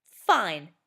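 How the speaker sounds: loud, sulky give-in; in a huff. A narrator's reading. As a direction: huff it out grudgingly. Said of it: "Nikki said in a huff".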